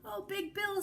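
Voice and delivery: put-on high, excited child voice; a little boy thrilled to find his toy; delighted discovery. A narrator's reading. Johnny finds his toy.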